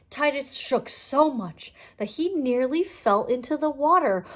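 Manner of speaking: ordinary delivery, nothing marked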